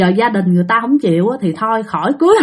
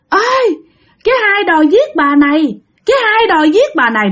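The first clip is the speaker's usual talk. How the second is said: mock child's alarm call, pitched up; child crying out; 8 semitones higher than usual. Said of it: "nó la làng lên". (giả giọng bé trai la làng, cao giọng)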